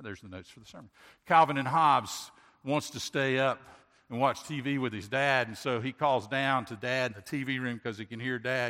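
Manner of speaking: ordinary talk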